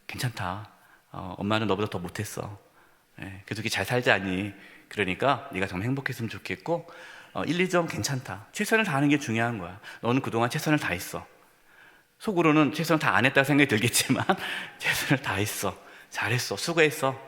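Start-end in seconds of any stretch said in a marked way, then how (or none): 0.1-11.3 low, soft, reassuring voice; consoling parent. Acted. Acted parent consoling a child. 14.8-17.2 soft, low words of praise; reassuring parent. Acted. Acted parent consoling a child.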